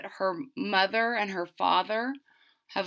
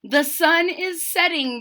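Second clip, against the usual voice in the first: higher, louder announcing call; runner calling out; 9 semitones higher, 8 dB louder than usual. Acted. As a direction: call it across the camp. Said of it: "the runner called".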